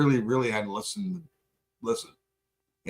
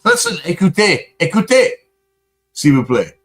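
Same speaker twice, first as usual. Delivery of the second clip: loud, mock French pleading in a higher voice; louder, higher mock French plea to listen; 6 semitones higher, 9 dB louder than usual. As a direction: beg them to listen in a hammed-up French accent. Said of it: "your fucking French fucking accent".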